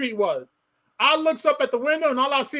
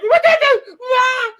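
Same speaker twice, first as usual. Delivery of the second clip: high, loud crying shout; retold crying yelp; 11 semitones higher, 7 dB louder, much slower than usual. A boy getting beaten in the shower, crying out high and loud. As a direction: wail it like a hurt kid. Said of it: "You know, you get that cry".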